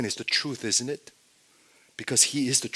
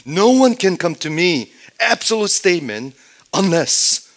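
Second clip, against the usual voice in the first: loud, raised preaching voice; emphatic declaration; 8 semitones higher, 10 dB louder than usual. Acted. Preacher declaring Jesus' absolute statement to the congregation, emphatically loud. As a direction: thunder it out as an absolute.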